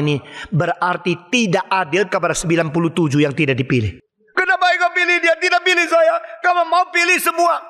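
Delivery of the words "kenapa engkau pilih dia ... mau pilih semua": put-on higher, whining child's protest; whiny complaint. Unchosen prisoner complains to the preacher, whining. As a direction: sulk like a child left out.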